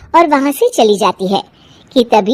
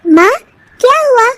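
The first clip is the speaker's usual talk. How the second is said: put-on higher girl's voice, asking; a child asking her mother what happened; young daughter, curious; daughter's voice; 11 semitones higher, 4 dB louder than usual.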